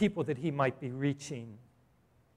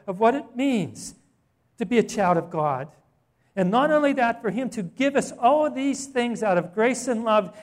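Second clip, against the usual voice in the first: rising, louder fervent preaching; building sermon climax; 3 semitones higher, 5 dB louder than usual. Acted. Preacher lifting the congregation toward heaven, fervent and loud. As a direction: swell with awe toward glory.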